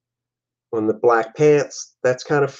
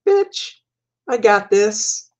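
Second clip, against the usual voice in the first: cocky taunt; 9 semitones higher, slower than usual. Acted. Cocky villain taunting while handcuffed.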